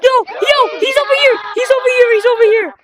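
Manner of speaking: urgent call-out. Real, not acted. Player warning friends where he is, urgent shout. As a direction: yell the warning over and over. Calling out monster to teammates.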